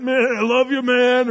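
lightly voiced construction guy; buddy at work. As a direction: clap a coworker on the back with it. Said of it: "You say something like".

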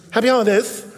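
prim, self-righteous holiday greeting; sanctimonious. Retold or imitated. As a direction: say it as if correcting someone, a touch preachy. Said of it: "As they'd say sanctimoniously".

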